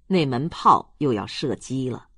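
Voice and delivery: usual speaking voice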